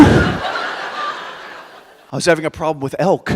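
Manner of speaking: very slow, offhand, laughing through it; deadpan reply. Retold or imitated. Asked about the cheetah, he answers in a slow deadpan. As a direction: shrug it off slowly as if it's normal.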